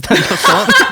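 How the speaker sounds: teasing with a laugh. Real, not acted. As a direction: ask it through laughter. Teasing question, laughing reply.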